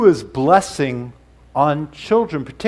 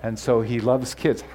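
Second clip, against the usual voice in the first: ordinary talk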